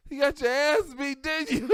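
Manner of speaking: high, loud smug question; knowing tease. Retold or imitated. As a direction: needle him with a knowing taunt. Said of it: "She said".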